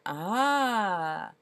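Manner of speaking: the whole crowd marvels at once; awed ah. A narrator's reading. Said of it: "Everyone went".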